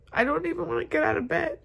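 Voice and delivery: crying it out, can't hold back; real crying. Real, not acted. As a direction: alone in bed, crying to the camera. Breaks down crying alone in bed.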